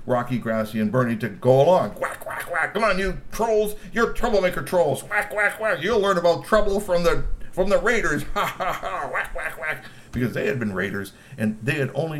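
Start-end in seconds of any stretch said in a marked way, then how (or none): 1.9-9.8 high taunting troll guard; mocking guard. Guards herding troublemaker trolls, high taunting. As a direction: taunt the troublemakers in a sing-song.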